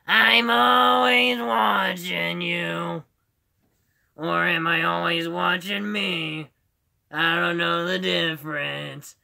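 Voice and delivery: loud, high, long-drawn monster voice; Roz. Shown as a demonstration. Streamer showing his Monsters, Inc. impression, drawn-out Roz voice. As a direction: stretch the vowels out loudly. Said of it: "I can also do Roz from Monsters".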